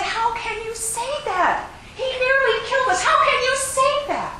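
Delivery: high, loud outraged demand; enraged. Acted. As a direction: shout it in disbelief. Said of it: "he was still enraged".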